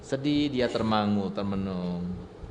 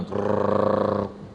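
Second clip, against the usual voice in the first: rolled buzzing imitation of a dentist drill; mock dental drill buzz; drill noise; 3 semitones lower than usual. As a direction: make the drill sound with the tongue.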